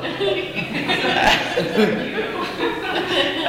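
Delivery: laughing hard and high; long giggling fit. Real, not acted. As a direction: let the laugh run long. Laughing at his own story.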